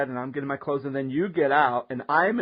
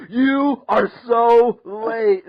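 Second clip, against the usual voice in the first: loud, slow, drawn-out scolding; hammed-up angry shout, higher pitch; imitated yelling; 5 semitones higher, 8 dB louder, much slower than usual. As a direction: stretch each word in a mock bellow. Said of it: "he's, like, yelling at you".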